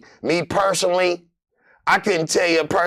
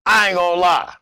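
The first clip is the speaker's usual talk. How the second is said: usual speaking voice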